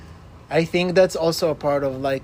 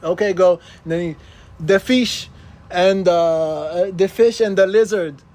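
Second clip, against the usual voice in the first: louder, higher Jamaican patois telling a silly joke; Jamaican patois accent; 3 semitones higher, 6 dB louder than usual. Retold or imitated. Prankster telling a stranger a joke, loud Jamaican patois. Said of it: "patois Jamaican accent".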